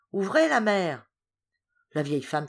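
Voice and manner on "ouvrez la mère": louder, higher shout to open up; shouted call. A narrator's reading. Hippel calling the old woman to open, shouting. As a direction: bang on the door with your voice. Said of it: "cria mon camarade".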